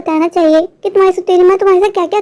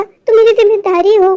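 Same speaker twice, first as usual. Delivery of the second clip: usual speaking voice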